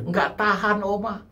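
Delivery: usual speaking voice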